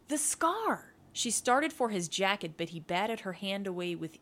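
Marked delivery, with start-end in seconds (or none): 0.1-0.9 high-pitched, impatient exclamation; exasperated retort. A narrator's reading. Woman demanding to see his wound, exasperated. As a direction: burst out with the obvious answer.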